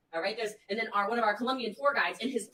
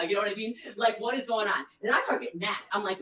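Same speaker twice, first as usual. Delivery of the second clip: ordinary talk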